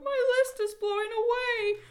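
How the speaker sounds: high, loud storybook wail; frantic, high-pitched dismay; panicked cry. A narrator's reading. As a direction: cry out in rising panic as Toad. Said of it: "cried Toad".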